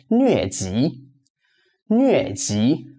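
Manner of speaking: "疟疾" falling, then rising; fourth then second tone. Shown as a demonstration. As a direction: drop on 疟, lift on 疾. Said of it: "fourth tone and second tone".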